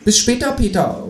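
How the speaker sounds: high, cheery called goodbye; kangaroo calling out. Acted. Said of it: "ruft das Teutelbier".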